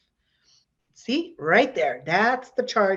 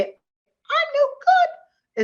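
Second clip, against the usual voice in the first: very high, drawn-out cartoon squeak; slow falsetto cartoon mouse, loud and exaggerated; Mickey Mouse; 7 dB louder, much slower than usual. Shown as a demonstration. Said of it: "say them in a Mickey Mouse voice".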